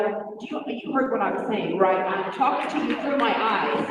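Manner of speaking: a firm hint of teacher voice; lightly put-on teacher telling off. Shown as a demonstration. Mother of six showing her teacher voice, lightly stern. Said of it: "My teacher voice sounds more like".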